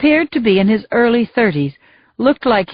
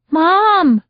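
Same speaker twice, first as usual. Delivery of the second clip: high, frightened cry for her mother; yelling for mom; 9 semitones higher than usual. A narrator's reading. Injured Yuki wakes blinded and yells for mom, high. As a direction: call out for mom, dazed and scared. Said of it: "she yelled".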